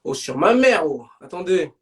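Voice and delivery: ordinary talk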